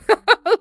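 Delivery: loud, high burst of laughter; real high, bright cackle. Real, not acted. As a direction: laugh at your own jab. Laughs at own comment.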